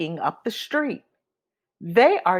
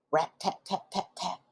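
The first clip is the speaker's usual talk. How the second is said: much softer "rat, tat, tat"; soft woodpecker tapping; 11 dB quieter than usual. A narrator's reading. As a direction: tap it out quietly. Woodpecker sound.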